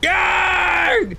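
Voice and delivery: very high screaming pirate yarg. Pretend. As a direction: bellow it like a pirate. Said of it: "done with the screaming".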